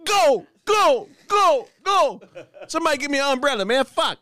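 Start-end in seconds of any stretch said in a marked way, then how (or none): 0.0-2.2 high, punchy repeated mock yells; comic high-pitched cheering-on; mock trainer yell. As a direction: urge them on like a trainer, hammed-up. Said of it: "a trainer in your stomach yelling".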